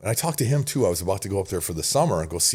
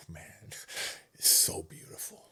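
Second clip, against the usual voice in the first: whispered awe; 8 dB quieter, slower than usual. Retold or imitated. Waking grateful each day.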